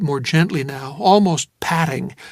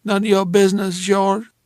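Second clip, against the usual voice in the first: put-on a touch of drunken sulk; 4 semitones higher than usual. Woman resists, slurring.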